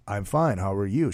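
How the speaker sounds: low, quiet smooth reply; low Gene voice. Retold or imitated. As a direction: keep it low and cool. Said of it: "He goes".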